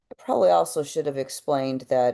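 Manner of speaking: ordinary delivery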